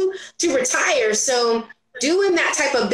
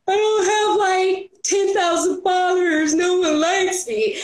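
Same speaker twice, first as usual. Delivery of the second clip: pity party whine; 7 semitones higher than usual. Retold or imitated. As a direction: moan it out like you're throwing yourself a pity party. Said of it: "I go throw my pity party".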